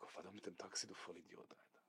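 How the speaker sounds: halb geflüstert, zu sich selbst; leise Selbstbeschimpfung. Retold or imitated. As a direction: murmel dir den Vorwurf selbst zu. Gedanke an sich selbst gerichtet. (leise geflüsterter Gedanke)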